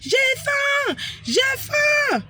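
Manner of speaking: high, mocking child's shout; child yelling. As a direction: yell it like a hungry kid throwing a tantrum. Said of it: "Il commence à crier".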